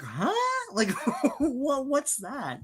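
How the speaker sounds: high, soft, half-laughing puzzlement; mock bafflement. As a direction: play the baffled moviegoer. Acts out baffled viewer.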